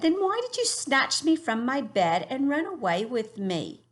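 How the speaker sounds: a girl in a put-on English accent asking why. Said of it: "we practice what I think is an English accent".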